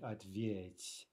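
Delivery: ответь stressed on ве; slow, clear model of ответь, stressed on ве; stem stress. Shown as a demonstration. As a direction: lean on the stem syllable ве in ответь. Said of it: "with the stem stress".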